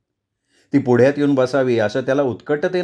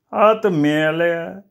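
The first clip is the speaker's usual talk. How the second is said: slow drawn-out curse; 4 semitones higher, much slower than usual. Acted. Sonya cursing the rooster.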